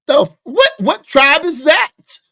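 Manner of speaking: high, loud, slow incredulous question; mock disbelief. Streamer mocking a claim to chat, high slow disbelief.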